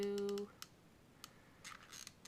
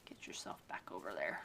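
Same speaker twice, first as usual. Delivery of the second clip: whispering. Real, not acted. Coaxing the decoration.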